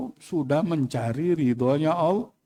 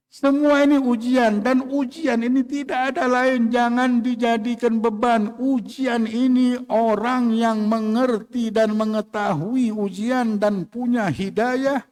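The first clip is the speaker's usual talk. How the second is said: fervent preaching; 6 semitones higher, slower than usual. Acted. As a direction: drive the point home to the congregation. Preacher rising to full force.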